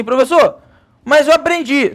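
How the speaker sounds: a high whiny student voice; mocking student. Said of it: "Aí o cara fala assim".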